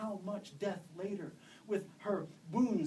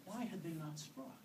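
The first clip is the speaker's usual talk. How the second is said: usual speaking voice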